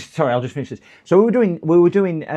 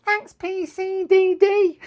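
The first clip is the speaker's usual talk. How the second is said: very high, slow put-on squeak; squeaky character thanks; 5 dB louder, slower than usual. Birthday boy thanking the singer, imitated in high squeak. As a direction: give thanks in a silly squeaky voice. Replies as Worms after the song.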